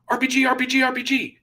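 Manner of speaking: urgent radio call. Retold or imitated. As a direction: call out the threat with adrenaline in your voice.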